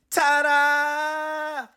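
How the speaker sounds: high, loud mock reveal; hammed-up ta-da; showy ta-da. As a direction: present the fake smile proudly. Jim puts on a fake smile.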